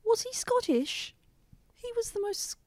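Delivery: high, slow, hammed-up Scottish lilt; putting on a Scottish accent like the flight attendant; mock Scottish accent. Asking about the flight attendant, in a mock Scottish accent. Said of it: "he sounded like".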